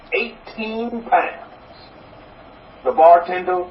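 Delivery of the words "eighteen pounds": slow, softer proud reply; proud father. Acted. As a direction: let the number drop slowly and proudly. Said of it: "The proud father answers".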